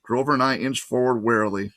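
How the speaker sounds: ordinary delivery, nothing marked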